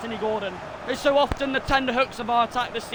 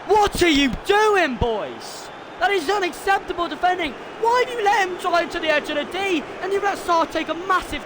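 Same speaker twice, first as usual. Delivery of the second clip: angry rant; 8 semitones higher than usual. Real, not acted. Fan scolding his team's defending after the goal, angry. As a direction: tear into the defenders.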